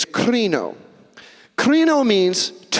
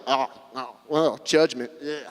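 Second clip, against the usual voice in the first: softer, lower wary hesitation; reluctant, uneasy; 4 semitones lower, 4 dB quieter than usual. Acted. Congregation hearing the word judge, reluctant and uneasy. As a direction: shrink back from the word with mild distaste.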